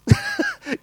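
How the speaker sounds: quick high laugh at his wife's salt habit; a real burst of laughter; short laugh. Real, not acted. Preacher laughing as he teases about his wife. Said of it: "she loves salt".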